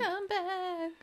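high, wailing plea after someone leaving; hammed-up distant cry; mock calling out. Joining in calling after her.

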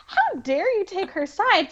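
high-pitched, drawn-out indignation; mock outrage. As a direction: imitate an affronted diva, high and slow. Acting Song-yi's outraged jealousy.

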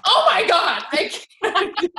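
high, loud panicked laugh. Retold or imitated. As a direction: squeal out a nervous refusal through laughter. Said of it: "I was like".

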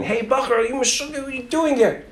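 high, held-back distant holler; softened yell from a passing wagon; acted high-pitched, incredulous call; mock scream. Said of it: "he hears the guy scream out of the wagon".

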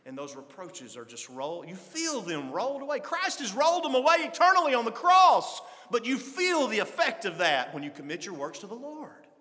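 loud, rising preacher's exhortation; full-force preaching. Acted. Preacher urging his church, at full force. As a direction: preach it hard to the congregation.

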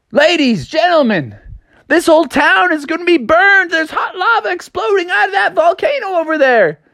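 high-pitched cartoon alarm; yelling a warning. A narrator's reading. Froggy warning the town square about lava, high urgent yell. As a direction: cry it out high, like a town crier. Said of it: "he started yelling to the town square".

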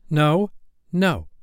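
decisive. A narrator's reading. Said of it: "he told Wendy decisively".

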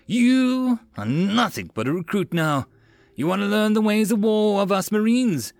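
high-pitched drill officer roar; forceful parade-ground dressing-down. A narrator's reading. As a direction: roar down at the new recruit. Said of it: "Arvin roared".

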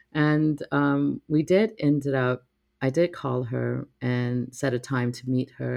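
usual speaking voice

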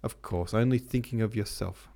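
ordinary delivery

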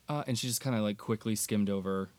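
normal talking voice

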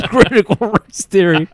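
high-pitched caricature breaking into laughter; mock outrage, cracking up; ranting neighbor. Podcaster as the neighbor naming his bugbear, indignant. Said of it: "He's like".

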